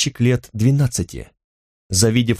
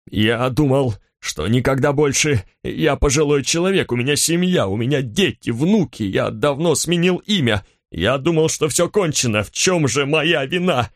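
put-on understated distressed old baker justifying himself; a touch of desperate self-defence; anxious pleading; 2 semitones higher than usual. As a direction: plead your innocence in a rush of short phrases. Frightened baker pleading his innocence.

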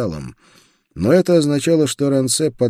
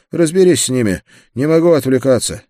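raised, higher commanding voice; urgent order; 7 semitones higher, 7 dB louder, faster than usual. A narrator's reading. Busy Рансе hands the knights to his partner, loud order. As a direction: call it over loudly, too busy to stop.

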